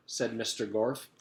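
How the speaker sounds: ordinary delivery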